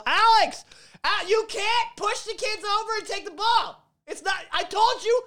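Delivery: high mocking teacher voice scolding the kid. Gym teacher scolds the kid for shoving, high mock scold. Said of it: "And they're like, whistle blow".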